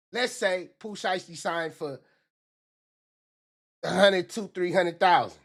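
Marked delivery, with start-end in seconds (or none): none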